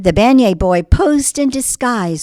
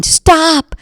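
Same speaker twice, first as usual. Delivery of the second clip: high, loud calling after him; shouting; 8 semitones higher, 5 dB louder than usual. Acted. Marcel chases the beignet boy, loud high shout. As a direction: yell down the street after the runaway.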